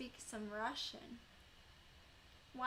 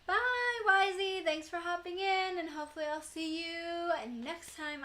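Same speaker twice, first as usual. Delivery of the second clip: much higher and louder, bubbly goodbye; cheery sign-off; 5 semitones higher, 8 dB louder than usual. Real, not acted. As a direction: wave the viewer off brightly. Stream sign-off to a viewer.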